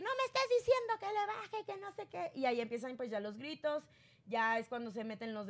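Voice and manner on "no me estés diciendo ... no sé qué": mock high yell. Retelling Adame yelling back.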